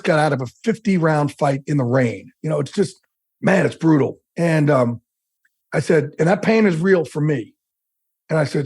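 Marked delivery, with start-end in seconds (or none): none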